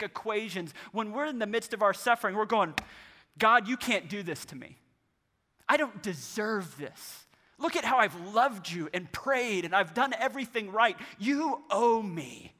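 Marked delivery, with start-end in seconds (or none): 3.4-12.5 higher, louder protest to God; indignant complaint. Acted. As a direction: plead your case as if wronged, pressing each phrase. Acted complaint to God in suffering.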